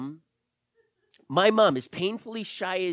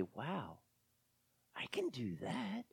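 very soft, half-whispered wonder; hushed realization; 15 dB quieter, slower than usual. Pretend. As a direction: let it dawn on you, almost under your breath. Imagined listener's quiet realization.

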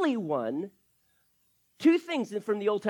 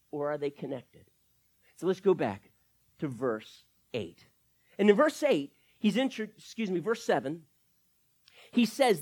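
ordinary talk, nothing marked

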